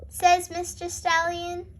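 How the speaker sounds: ordinary delivery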